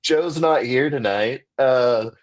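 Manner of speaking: high, slow mock-polite brush-off; sarcastic, sing-song high brush-off; sarcastic reply. Comic answering a drunk heckler, high sarcastic politeness. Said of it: "It's like".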